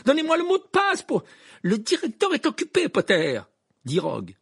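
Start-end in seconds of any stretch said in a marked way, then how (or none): 0.1-1.2 very high, loud, frantic outpouring; urgent, panicky yelling; panicked. A narrator's reading. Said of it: "dans cet état de panique". 1.6-3.4 high, loud, pointed put-down; spiteful teacher voice; gloating refusal. A narrator's reading. Snape gloatingly refuses Harry.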